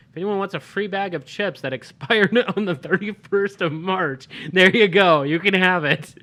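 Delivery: loud, laughing while in pain; laughing through pain. Real, not acted. Laughing after hot chip.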